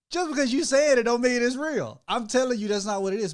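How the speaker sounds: heated protest. Retold or imitated. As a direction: push back hard, mock indignant. Husband retells arguing back.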